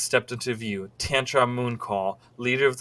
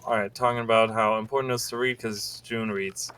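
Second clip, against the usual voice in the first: ordinary talk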